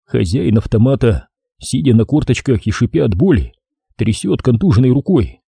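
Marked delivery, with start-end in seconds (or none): none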